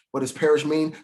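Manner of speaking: usual speaking voice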